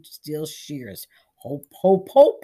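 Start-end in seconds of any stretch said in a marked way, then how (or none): 1.3-2.4 rising loud cackle; cackling laugh. Acted. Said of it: "he laughed like that".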